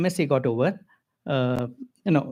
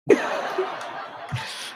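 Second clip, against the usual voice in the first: soft laugh; 4 dB quieter than usual. Real, not acted. Laugh after the joke.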